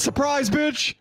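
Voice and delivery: mock surprise shout. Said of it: "popped out of a vent and said".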